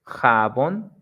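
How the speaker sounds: "jabón" as ja-BÓN, rising at the end; stress on last syllable. Shown as a demonstration. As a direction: lift the second syllable of "jabón". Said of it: "like in the examples".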